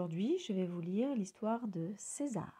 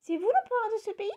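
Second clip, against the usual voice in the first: very high, louder question; little bird César; 13 semitones higher, 5 dB louder than usual. A narrator's reading. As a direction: chirp up politely to the crocodile. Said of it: "pour engager la conversation".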